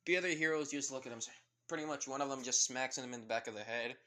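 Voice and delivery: plain reading voice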